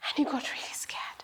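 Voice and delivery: hushed, whispered narration; soft suspenseful whisper; scared whisper. A narrator's reading. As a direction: whisper it as if frightened. Storyteller narrates fear in whisper.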